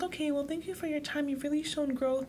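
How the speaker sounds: therapist wrapping up. Acted. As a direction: imitate a courteous end-of-session send-off. Acting out therapist closing the session.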